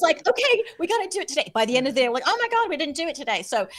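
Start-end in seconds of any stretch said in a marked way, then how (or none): 0.3-1.5 high, fast and chirpy; eager. Retold or imitated. Quoted upbeat resolve. 2.3-3.4 high, fast, alarmed; mock panic. Quoted panic realization.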